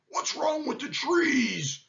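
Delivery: high, slow, drawn-out complaint; exasperated lion. A narrator's reading. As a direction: stretch the question out in frustration. Lion exasperated by shaking trees.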